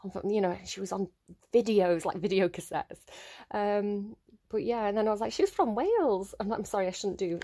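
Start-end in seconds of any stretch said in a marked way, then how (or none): 5.3-6.3 sing-song, higher mock Welsh accent; a deliberately bad Welsh accent, played for a laugh. Reader jokingly mimics [name]'s homeland, terrible Welsh accent. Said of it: "a terrible Welsh accent".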